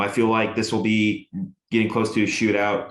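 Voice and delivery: ordinary talk, nothing marked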